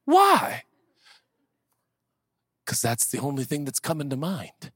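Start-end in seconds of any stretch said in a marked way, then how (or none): 0.0-0.6 high, loud disbelieving why; a shrill, scoffing why; incredulous doubter. Acted. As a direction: fling it back in disbelief.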